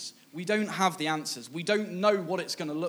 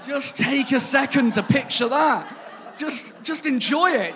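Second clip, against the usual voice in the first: higher and louder, slow, laughing through it; 7 semitones higher, 6 dB louder, slower than usual. Real, not acted. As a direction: savour it, barely holding in laughter. Savouring the funny image.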